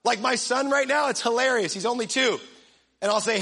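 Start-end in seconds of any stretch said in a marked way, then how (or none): none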